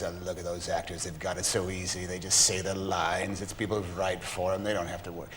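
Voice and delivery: low, soft, grumbling aside; envious musicians. Acted. Musicians eyeing the actors across the patio, mock envious grumbling. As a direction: grumble quietly about the lucky ones over there. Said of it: "the musicians are all looking at the actors".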